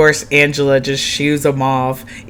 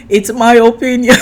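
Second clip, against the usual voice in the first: high, loud mock protest; hammed-up imitation of a man defending himself; mimicking Michael; 8 semitones higher, 6 dB louder than usual. Man answering an accusation, playfully mimicked. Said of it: "he was like".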